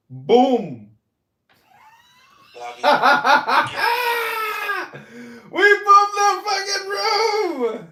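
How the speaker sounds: high, loud gloating laugh; triumphant shout. Real, not acted. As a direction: crow over the win. Gloating after booting someone.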